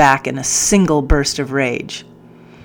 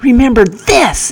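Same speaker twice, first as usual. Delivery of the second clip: high loud enraged yell; furious threat; 8 semitones higher, 7 dB louder than usual. Acted. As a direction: scream it in threat. Captain threatens with stick.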